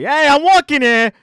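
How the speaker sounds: loud, high New York shout: Hey, I'm walking here; New York accent. Acted. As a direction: belt it like a New York cabbie yelling at traffic. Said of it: "the line everyone knows around N Y C".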